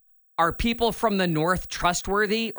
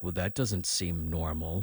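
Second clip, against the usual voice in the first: puzzled mutter; 13 semitones lower, 9 dB quieter than usual. Retold or imitated. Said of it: "I'm like".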